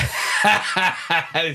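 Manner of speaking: breaks into a real laugh; can't hold the laugh in; helpless burst of laughter. Real, not acted. Laughing at his own story.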